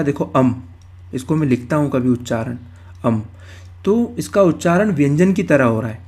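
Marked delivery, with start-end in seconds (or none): 0.3-0.5 "अम" said as a vowel closed by an m; pronunciation demo. Shown as a demonstration. Teacher shows students how it is pronounced, "अम" closed on m. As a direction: show how "अम" sounds, ending on a consonant. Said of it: "उच्चारण क्या होगा इसका".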